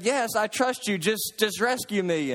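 lightly acted urgent begging; pleading. Man dangling from cliff begs to be rescued, mock pleading. Desperate man in retold story.

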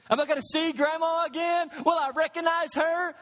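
lightly voiced worried church member; worried churchgoer. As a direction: call out the question like a fretting mourner. Voicing a worried churchgoer's question.

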